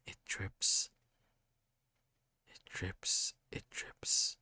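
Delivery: breathy repeated whisper; eerie whisper. A narrator's reading. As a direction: let each one fall like a drop. Narrator repeats an eerie line.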